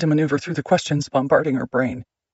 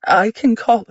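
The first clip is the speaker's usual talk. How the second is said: panicked; 8 semitones higher, 6 dB louder, slower than usual. Acted. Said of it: "Cassie's panicked voice".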